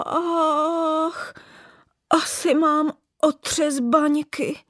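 high, slow and shaken. Acted. Old bauble groaning from under the bookcase, shaken. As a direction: moan it out dazed. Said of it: "opravdu otřeseným hlasem".

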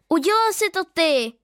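high, loud accusing cry. Acted. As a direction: shout the blame at him. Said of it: "vykřikla jsem".

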